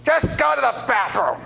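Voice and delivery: high, loud, slow yell; as loud as he can. Retold or imitated. Old man yelling advice at the pastor, high and loud. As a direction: holler it across the aisle. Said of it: "as loud as he can".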